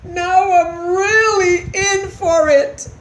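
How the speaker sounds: loud, high, slow wail; dreading trouble. A narrator's reading. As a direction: draw it out in dismay. Christopher caught by Mama.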